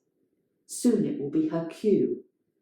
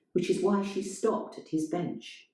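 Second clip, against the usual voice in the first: ordinary delivery, nothing marked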